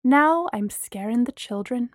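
ordinary delivery, nothing marked

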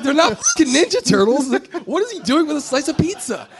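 high, exasperated disbelief with laughter; incredulous retort. Retold or imitated. Director objecting to the pizza idea, laughing incredulity. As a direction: protest it loudly, pitch way up, half laughing.

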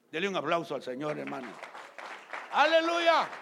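loud, high cry of praise; preacher's shout. Acted. Pastor calling the church to applaud, shouting praise. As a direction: rouse the whole church.

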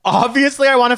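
high, loud, excited yes. Real, not acted. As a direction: gush it like an eager fan. Eager yes to [name].

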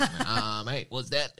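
mock Aussie, loud and laughing; Aussie accent. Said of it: "His Aussie accent was pretty".

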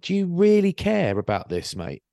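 high-pitched exasperated questioning; incredulous challenge. Retold or imitated. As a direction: plead in disbelief with a petty stranger. Retold incredulous challenge to cyclist.

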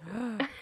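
sharp, quiet intake of breath; shocked gasp. Real, not acted. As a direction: gasp as if personally offended. Reacts to breakfast called gross.

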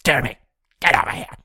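mock neighborhood yelling; yelling for Jeremy. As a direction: run around the street hollering his name.

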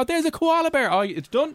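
high-pitched mock relief. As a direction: squeak it out lightly, relieved.

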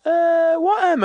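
high, drawn-out mock cluelessness; feigned innocence. Scan woman covering up the M, mock innocent. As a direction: stall in a high put-on voice.

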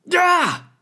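sharp high yip of pain. Acted. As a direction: yip as if the claws bite. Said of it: "Raccoon yipped".

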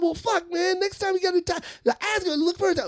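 very high, exasperated scolding; mock yelling. Emcee scolding Bob about next time, mock yelling. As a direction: imitate an emcee fed up, voice way up. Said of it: "He was just yelling at Bob".

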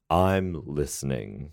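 slow radio-host catchphrase; Frasier Crane. Acted. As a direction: draw it out like a radio psychiatrist. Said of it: "I'm Doctor Fraser Crane".

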